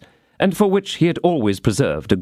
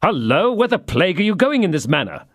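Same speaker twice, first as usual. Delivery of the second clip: raised, startled call after a running man; surprised call; 7 semitones higher than usual. A narrator's reading. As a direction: stop him with a sharp question. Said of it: "cried Athos".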